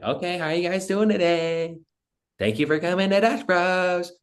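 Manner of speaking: high, bubbly Valley Girl impression of the barista; Valley Girl accent. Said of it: "that classic Valley Girl accent where she's like".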